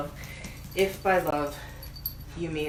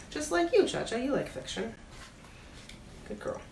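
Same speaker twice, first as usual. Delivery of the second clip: soft, fond praise for a pet; tender aside to the cat; 5 semitones higher, 5 dB quieter, faster than usual. Real, not acted. Bookseller breaks off the story to praise the cat, soft and fond. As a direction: coo it gently to the cat.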